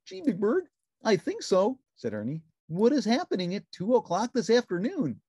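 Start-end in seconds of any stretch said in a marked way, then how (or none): 0.0-1.8 high, bright puppet voice; cheerful little puppet; Ernie voice. A narrator's reading. Narrator voicing Ernie answering the phone, high puppet voice. 2.7-5.2 high, bright puppet voice; cheerful little puppet; Ernie voice. A narrator's reading. Narrator voicing Ernie answering the phone, high puppet voice.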